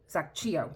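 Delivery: Zacchio with an Italian ch sound; Italian pronunciation. Shown as a demonstration. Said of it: "I'm going with my Italian now".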